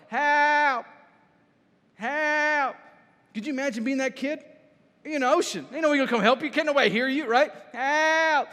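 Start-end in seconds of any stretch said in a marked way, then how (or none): 0.1-2.8 high, loud drawn-out shout; crying out for help. Retold or imitated. Preacher imitates a kid adrift at sea, loud cry for help. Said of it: "He was crying out for help". 7.7-8.5 long, high mock shout; crying out for help. Kid nobody can hear in the ocean, high shouted cry for help. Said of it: "He was crying out for help".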